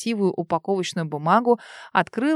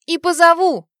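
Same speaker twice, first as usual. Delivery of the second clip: desperate shout; 10 semitones higher, 7 dB louder than usual. A narrator's reading. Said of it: "отчаянно выкрикнула сводная сестра".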